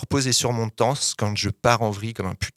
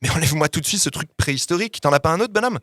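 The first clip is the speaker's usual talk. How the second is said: higher, indignant exclamation; exclaimed order; 6 semitones higher, faster than usual. A narrator's reading. Coach ordering his boxer to change shirt, exclaiming. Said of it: "s'exclame aussitôt".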